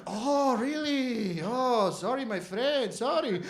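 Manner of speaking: high, lilting mock apology; apologetic shopkeeper. Doner guy learns customer isn't Turkish, effusively apologetic. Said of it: "But then it starts like".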